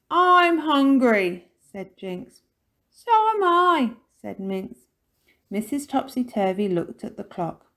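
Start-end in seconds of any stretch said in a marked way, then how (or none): 0.1-1.5 loud, high, drawn-out complaint; hungry child. A narrator's reading. Jinx announcing hunger, loud drawn-out child's complaint. 2.9-4.0 high, loud, drawn-out echo; complaining child. A narrator's reading. Minx agreeing with Jinx, high drawn-out complaint.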